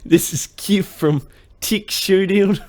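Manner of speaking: slow, high, loud mock Kiwi accent; Kev impression. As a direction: exaggerate a New Zealand accent, close to laughing. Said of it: "I'm gonna do his intro".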